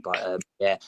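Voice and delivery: ordinary talk, nothing marked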